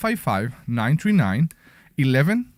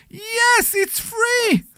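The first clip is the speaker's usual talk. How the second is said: excited; much slower than usual. Acted. Hyping the free class on air.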